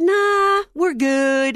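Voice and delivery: a high, loud mock drawl of nah; husband's lazy refusal. To his wife over coffee, a lazy, loud mock brush-off. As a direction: mimic the husband waving it off.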